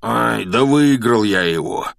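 slow, reluctant admission; confessing. A narrator's reading. Hagrid admits winning the egg, sheepish confession. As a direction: drag it out, owning up. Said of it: "признался Хагрид".

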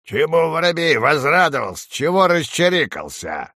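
crow's scolding question; nasty cawing crow. A narrator's reading. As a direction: caw it out as an unpleasant old crow. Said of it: "каркнет своим противным голосом".

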